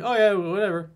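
high, loud careless "whatever"; dismissive mock. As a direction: toss it off like a clueless guy. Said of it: "you're like".

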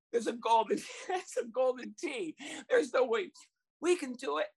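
high, hammed-up blubbering; mock sobbing. Coach fake-sobs as kids pat his back, high blubbering. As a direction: whimper like a beaten man. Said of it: "I'm sobbing".

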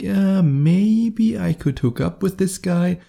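high, drawn-out, noncommittal musing; lukewarm maybe. Acted. Girl half-considering the guy she just met, lukewarm maybe. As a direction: stretch it out, lilting up as if half-considering it. Voices a lukewarm girl's thought.

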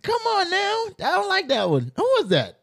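very high, loud come on now; outraged surprise. Real, not acted. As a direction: squeal in outraged disbelief. Outraged by the post.

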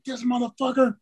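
mock raging mutter; raging curse. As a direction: spit it out in fury. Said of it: "I was just raging".

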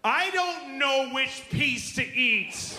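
high, slow, stretched wail; mock toddler meltdown; crazed little girl. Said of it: "her brain has to go somewhere crazy".

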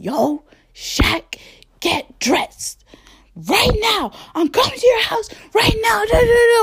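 high, loud, panicked imitation of the friend; mimicking the friend's frantic shouting phone call; imitating a freakout. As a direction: squeal it out like a friend in a frenzy on the phone. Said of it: "why you calling me freaking out".

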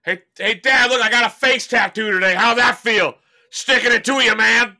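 put-on high, loud taunting teen voice; mock bratty son. Son taunting his dad about a face tattoo, loud and high. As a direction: jeer at dad like a smug kid.

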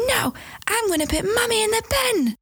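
high-pitched imitation of a cross little boy; defiant child. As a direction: squeal it like a defiant small child. Mum voices angry small son.

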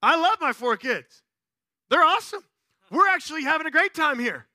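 high, loud indignant retort; snapping back. Retold or imitated. Dad answering pitying shoppers, snapping back. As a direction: defend your family emphatically. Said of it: "I've snapped back".

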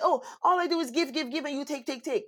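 high whiny mock of a self-pitying giver; mocking complainer. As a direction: parody the martyr who keeps giving, pitched high. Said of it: "you ever hear people say".

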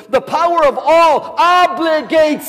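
high, loud preacher's climax; preaching at full force. Acted. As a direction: shout it out like a revival preacher. Preaching builds to a shout.

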